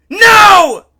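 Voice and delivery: high, all-out scream; screamed refusal. A narrator's reading. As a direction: scream it while struggling. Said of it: "Giff screamed".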